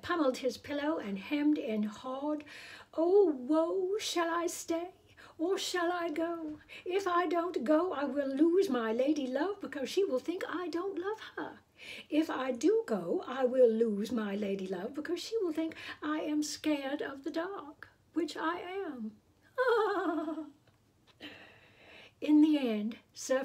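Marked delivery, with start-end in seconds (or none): 2.9-19.1 high, wailing lament of a fearful knight; anguished knight. Acted. Knight wailing alone over his dilemma, high and tearful. Sir Fred's anguished dithering. 19.6-20.5 high, bright burst of a laugh. Acted. Narrator laughing at the knight's woe, high. After Sir Fred's lament.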